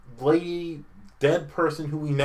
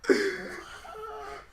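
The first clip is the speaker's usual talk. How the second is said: high, laughing squeak of disbelief; shocked squeak; 4 dB quieter than usual. Retold or imitated. Wife hearing Greece mid-sex, shrieking with laughing disbelief. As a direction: squeal it like she can't believe her ears.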